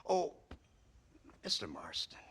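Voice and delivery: ordinary talk, nothing marked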